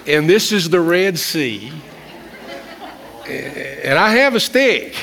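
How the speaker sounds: mock Moses prayer to part traffic. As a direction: play it like a cheeky bargain with God. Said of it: "I wanted to pray".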